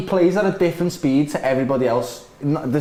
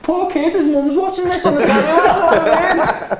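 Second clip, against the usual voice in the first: amused laughing; 12 semitones higher, 4 dB louder than usual. Real, not acted. Laughing at the wrong name.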